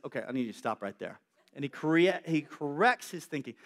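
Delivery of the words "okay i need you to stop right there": calm voice of God. Acted. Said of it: "God says".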